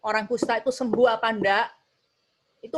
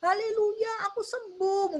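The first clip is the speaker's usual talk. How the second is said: high, crying-out praise; healed Samaritan crying haleluya, voice much higher; tearful praise; 12 semitones higher than usual. Acted. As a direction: sob out your joyful thanks. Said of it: "teriak-teriak memuliakan Tuhan".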